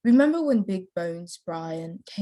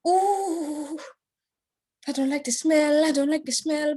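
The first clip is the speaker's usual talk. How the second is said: higher, squeamish little voice; mock disgusted ooh; 6 semitones higher than usual. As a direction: imitate your younger self wrinkling your nose. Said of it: "I was like".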